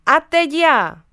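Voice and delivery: loud gleeful shout; playful call. A narrator's reading. Mum calling out before jumping on the crocodile, loud and playful. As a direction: announce your turn with a whoop. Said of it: "A mamka zavolala".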